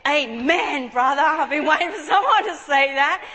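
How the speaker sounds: loud, whooping "amen, brother" outburst; exuberant shout. Retold or imitated. She answers the preacher at last, exuberant shout. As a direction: shout it like a long-awaited answer. Acts out her relieved reply to preacher.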